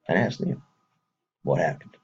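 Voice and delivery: ordinary delivery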